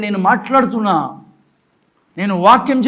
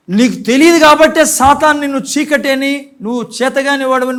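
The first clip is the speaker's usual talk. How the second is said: usual speaking voice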